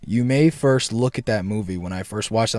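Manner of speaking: usual speaking voice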